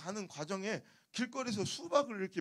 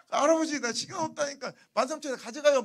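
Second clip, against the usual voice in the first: louder, higher insistent mock plea; impatient haggling customer; 4 semitones higher, 8 dB louder than usual. Gyeongsang customer telling grandpa time is short, impatient. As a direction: push to close the deal now. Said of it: "그걸 또 모르고".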